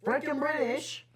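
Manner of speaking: high, hammed-up British accent. Players declaring a character canonically British, in mock British accent. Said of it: "I am now making an accent".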